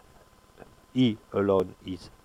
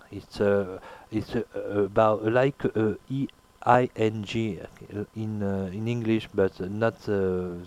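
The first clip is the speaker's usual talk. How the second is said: ordinary delivery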